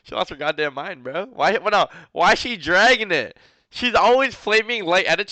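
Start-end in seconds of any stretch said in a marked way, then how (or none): 1.2-5.3 outraged. Real, not acted. Outraged at her tweet.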